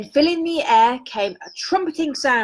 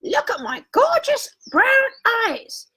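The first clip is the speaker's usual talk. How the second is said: slow, much higher, crying shriek of a vain ostrich; squealing ostrich showing off; 13 semitones higher, slower than usual. A narrator's reading. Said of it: "The ostrich shrieked".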